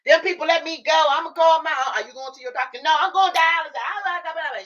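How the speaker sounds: high mock mom voice rattling on; imitating mom. Daughter mimics mom's busy chatter, high.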